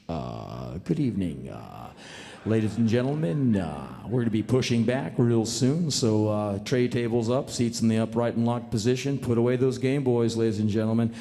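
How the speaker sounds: calm captain reassuring passengers; laid-back pilot. Acted. Pilot announcement impression.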